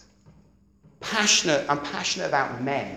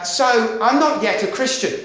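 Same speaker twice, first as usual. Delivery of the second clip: blunt admission; 8 semitones higher, 7 dB louder than usual. Retold or imitated. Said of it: "I stood up and I said".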